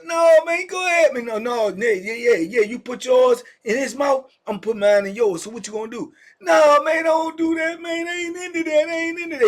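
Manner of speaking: high, loud, near-sobbing begging; crying plea. Retold or imitated. Man begging the armed cellmate to stop, high crying plea. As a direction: beg him not to do it, voice cracking high.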